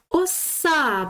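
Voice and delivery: "Оса" with the final А stressed and drawn out. Shown as a demonstration. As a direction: hit the last vowel of "Оса". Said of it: "наголос на букву А". (наголос на останню А у слові «Оса»)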